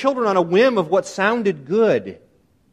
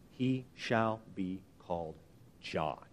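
very soft, low, halting words; awkward addition; 11 semitones lower, 17 dB quieter, slower than usual. Retold or imitated. Mother adds the name to stunned relatives, softly and awkwardly. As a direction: add it awkwardly, sinking almost to nothing.

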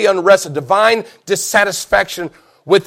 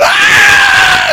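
piercing, very high loud scream; long mock shriek of terror; imitated screaming; 6 dB louder than usual. Bride and husband going down the hill, screaming. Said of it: "she's screaming".

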